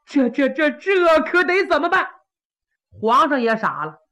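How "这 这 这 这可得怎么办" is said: a touch higher, stammering in dismay; exasperated prince. Acted. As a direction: flounder over what to do now. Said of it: "把个八王急得".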